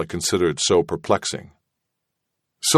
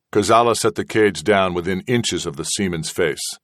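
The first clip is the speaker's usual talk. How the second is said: usual speaking voice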